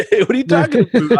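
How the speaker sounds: put-on high, laughing Canadian accent; hammed-up Canadian accent. Canadian playing innocent to armed boarders, hammed-up Canadian accent. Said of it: "I put on my best Canadian accent".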